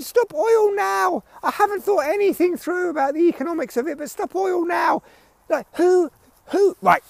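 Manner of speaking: mocking a shrill protester. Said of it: "I said".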